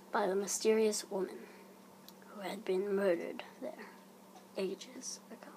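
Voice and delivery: fading into an eerie whisper; hushed creeping horror. A narrator's reading. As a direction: let it trail off into a spooky hush. Ghost story's eerie climax.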